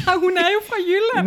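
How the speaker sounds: high, loud, amused exclamation; amused outburst. Real, not acted. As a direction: burst out, tickled by the discovery. Amused outburst at listener's dialect.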